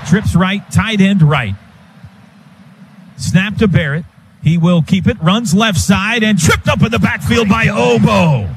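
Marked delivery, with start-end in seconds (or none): 3.2-8.5 higher, shouted call of the stop; rising call as he's tripped up; excited play-by-play. Real, not acted. Live call of tackle for loss.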